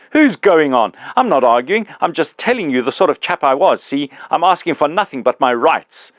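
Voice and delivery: put-on the big ghost confronting his old employee, loud and aggrieved; indignant big ghost.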